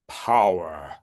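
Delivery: Power dropped low; low grounded Power. Shown as a demonstration. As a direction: drop Power deep into the chest. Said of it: "There's a power there".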